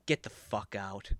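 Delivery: low, hushed, slow dismissal; sincere contempt. Acted. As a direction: imitate a film villain, dead serious and quiet. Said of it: "And it's so sincere".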